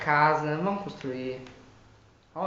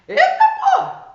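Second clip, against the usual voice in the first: sudden high startled yelp; startled shout; 16 dB louder than usual. Real, not acted. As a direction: jump out of your skin.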